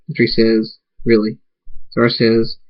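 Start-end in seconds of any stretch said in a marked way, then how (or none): none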